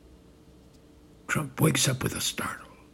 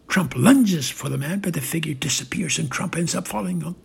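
usual speaking voice

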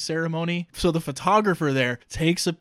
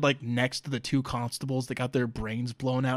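usual speaking voice

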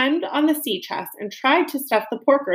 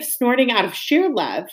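plain reading voice

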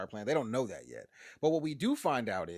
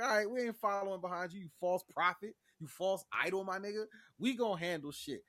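higher sassy scolding voice; mock Bev scolding; 6 semitones higher than usual. Bev scolds the pastor in a higher sassy tone. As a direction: scold him like a false prophet, pitched up.

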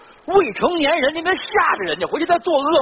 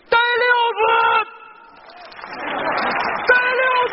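calling out; 12 semitones higher, much faster than usual. Acted. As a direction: call the next act in. Calling again, no answer.

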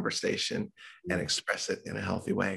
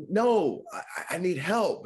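higher, slow pleading; desperate plea; 9 semitones higher, much slower than usual. Retold or imitated. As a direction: beg slowly for help. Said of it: "And I'm like".

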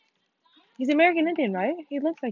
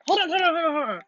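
quick urgent hold on, pitched up; 6 semitones higher, faster than usual. Real, not acted. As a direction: stop them mid-sentence, alarmed. Stopping the friend, surprised.

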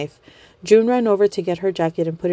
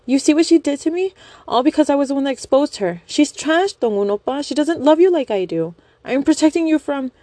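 high pleading fangirl; 8 semitones higher than usual. Acted. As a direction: beg him in a high whine. Young woman pleading with idol.

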